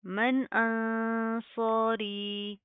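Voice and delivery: very slow sung tajweed recitation; Quranic recitation. Shown as a demonstration. Teacher recites the verse slowly for students. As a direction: chant it slowly, drawing every vowel out. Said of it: "recitation demo".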